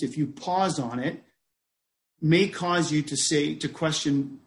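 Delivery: ordinary talk, nothing marked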